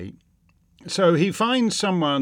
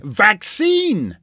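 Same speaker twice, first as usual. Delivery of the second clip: mock punchline; 5 semitones higher, 5 dB louder than usual. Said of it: "he'd say".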